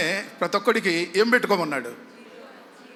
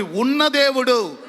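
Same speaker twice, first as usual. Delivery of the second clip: slightly raised, drawn-out call; 5 semitones higher, 6 dB louder, much slower than usual. Shown as a demonstration. Preacher prompting everyone to repeat, raised call. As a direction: lead the crowd, voice starting to lift. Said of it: "గట్టిగా చెప్పండి, ఇట్లా చెప్పండి".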